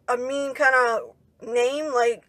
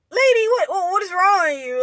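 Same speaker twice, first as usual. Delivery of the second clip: high, loud retold exasperation; retelling an indignant comeback; exasperated retort; 6 semitones higher, 3 dB louder than usual. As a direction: protest in a raised, incredulous pitch. Retold retort to being insulted.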